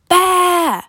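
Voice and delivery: high, drawn-out yell; long high holler of alert. A narrator's reading. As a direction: call it out high to a companion. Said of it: "I yelled to Dusan".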